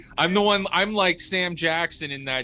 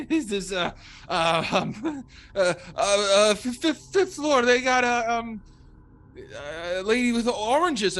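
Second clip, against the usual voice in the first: nervous stammer; 7 semitones higher than usual. Acted. Scared character stammering directions.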